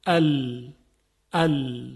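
isolated L held with tongue tip on the upper gums; lam sound demo. Shown as a demonstration. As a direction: say «ال» as a clean, clear L. (تلفظ جداگانهٔ «ال» با سر زبان روی لثه)

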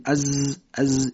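"از" with a buzzing z like a bee. Shown as a demonstration. Teacher imitating a bee's buzz for the ز. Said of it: "شبیه وزوز زنبور".

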